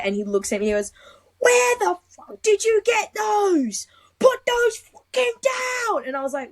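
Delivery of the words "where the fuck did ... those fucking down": very high, drawn-out mock shout; retold angry yell. Instructor catches her with the caches, irate high-pitched yell. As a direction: yell slowly in a high, outraged voice.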